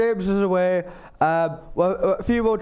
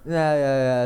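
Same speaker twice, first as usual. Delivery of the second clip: ordinary talk, nothing marked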